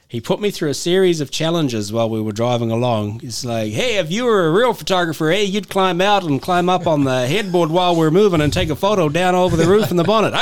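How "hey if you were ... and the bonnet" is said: louder, higher mock Canadian trucker; Canadian truck driver. Canadian trucker daring the photographer to climb out, imitated. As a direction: imitate a trucker pushing the challenge. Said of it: "with a Canadian truck driver".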